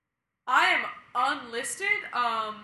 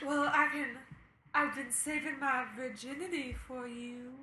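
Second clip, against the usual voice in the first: sexy voice; 9 dB quieter than usual. Acted. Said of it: "my sexy voice".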